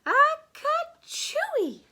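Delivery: very high, loud, drawn-out mock sneeze; slow build to an exploding "ka-chooey"; hammed-up storybook sneeze; big pretend sneeze. A narrator's reading. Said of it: "The kitty boy sneezed".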